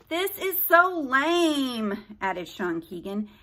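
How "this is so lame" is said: slow, high complaining drawl; whiny teen voice. Acted. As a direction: whine like a bored teen. Bored teen character voiced.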